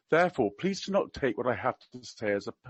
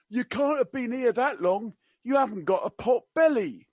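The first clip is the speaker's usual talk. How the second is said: higher mock-Cockney quip; attempted Cockney; 7 semitones higher than usual. Quoting the prince to a Briton abroad, in attempted Cockney. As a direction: have a go at Cockney, pitched up. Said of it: "I can't do Cockney. I will try my best".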